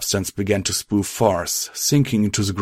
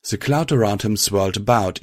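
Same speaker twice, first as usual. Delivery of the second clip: ordinary delivery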